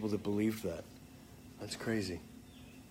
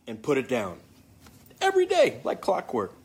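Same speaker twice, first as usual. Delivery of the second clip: normal talking voice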